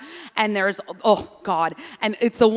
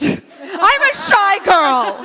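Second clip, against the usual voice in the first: high, drawn-out comic holler; mock shouting; 9 semitones higher, 4 dB louder, much slower than usual. Shown as a demonstration. Teasing about shouting shyness into a microphone, mock shout. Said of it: "I think it might be because you shouted it".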